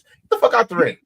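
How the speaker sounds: yelling at the TV. Retold or imitated. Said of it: "I was cursing my T V".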